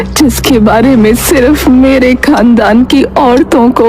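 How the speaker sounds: put-on old grandmother, weak but urgent; lightly voiced dying grandmother. Grandmother reveals family recipe.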